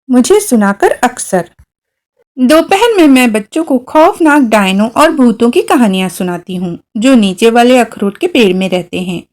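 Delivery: ordinary delivery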